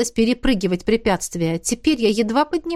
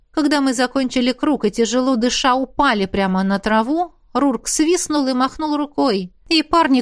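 plain reading voice

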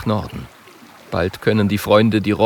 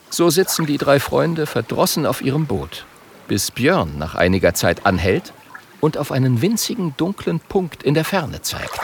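usual speaking voice

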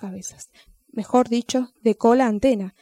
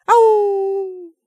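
high, loud yelping dog wail; dog howling cry; 9 semitones higher, 6 dB louder than usual. Acted. Frightened dog howling a high crying yelp. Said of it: "comenzó a llorar el perro".

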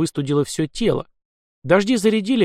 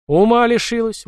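sharp indignant cry, higher and louder; indignant exclamation, higher and louder; indignant outburst; 9 semitones higher, 4 dB louder than usual. A narrator's reading. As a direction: snap at her in disbelief. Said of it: "возмутился Лёха".

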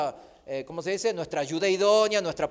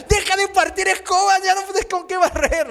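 higher and louder, a mock scolding mother; 10 semitones higher, 7 dB louder than usual. Preacher imitating his mom scolding his dad, loud and high. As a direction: voice mom scolding about broken brooms. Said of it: "mi mamá decía".

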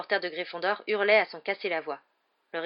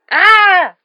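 loud, much higher yell; battle cry; 13 semitones higher, 19 dB louder than usual. Acted. Harry diving at the opponents, loud high yell. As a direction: charge in with a yell.

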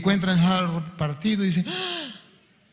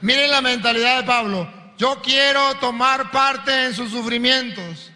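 slow, raised sermon voice; fervent preaching; 5 semitones higher, 3 dB louder, slower than usual. Real, not acted. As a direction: preach it, leaning on each word. Preaching at full force.

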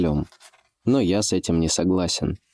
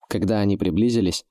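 ordinary delivery

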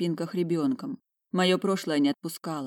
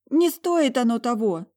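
high, emotional insistence; upset plea; 7 semitones higher, 4 dB louder than usual. A narrator's reading. Talia saying it isn't worth it, pleading. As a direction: implore her, voice raised in pitch.

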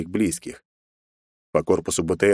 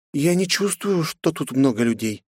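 put-on young woman voice; 7 semitones higher than usual. Grace senses something.

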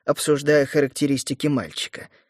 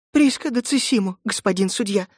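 holding back tears; 6 semitones higher than usual. Acted. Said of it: "едва сдерживая слёзы".

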